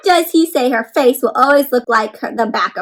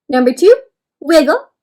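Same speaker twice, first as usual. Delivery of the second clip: rising, louder call of each step; excited steps; 4 semitones higher, 6 dB louder than usual. Shown as a demonstration. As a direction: announce each worm move with gusto. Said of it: "Squirm's kinda like wiggle".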